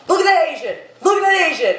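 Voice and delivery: mock cab driver, pitched high and excited; quoting the cab driver. Friend imitates the cab driver's excited rant, high-pitched. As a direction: ham up the ranting driver, high and insistent. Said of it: "He goes".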